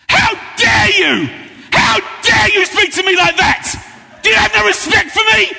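hammed-up public shouting match; mock angry outburst. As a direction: tear into her in a staged fury on the platform. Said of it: "Do you wanna do the angry game?".

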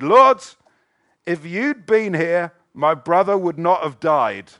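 high, loud grieving cry to Jesus; anguished plea. Acted. Mary falling at Jesus' feet, crying out her grief. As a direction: plead brokenly, voice lifted high.

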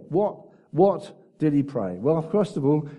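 ordinary delivery

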